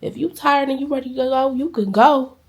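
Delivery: loud, pleading, slowed on let me be there; passionate plea. Retold or imitated. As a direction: beg him to wait, voice rising. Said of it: "So I told him".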